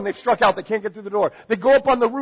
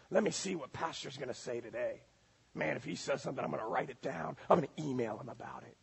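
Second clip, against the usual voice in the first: low, hushed note-taking to oneself; very soft, low voice of a secret critic; nitpicking churchgoer; 4 semitones lower, 14 dB quieter, faster than usual. Acted. Churchgoer privately picking at the pastor's sermon, hushed and low.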